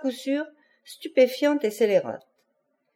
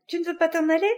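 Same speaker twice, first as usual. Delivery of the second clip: higher, insistent furious question; crying out; 8 semitones higher, much faster than usual. A narrator's reading. Conchita presses Mathéo to go away, crying out in fury. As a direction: push the pitch right up, without shouting. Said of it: "cria-t-elle".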